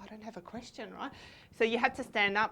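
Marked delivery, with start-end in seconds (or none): 0.0-1.1 whispered under the breath; panicked aside. Retold or imitated. Picked from the crowd with nothing to ask, whispered aside. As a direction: whisper it so only you can hear. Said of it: "And I was like".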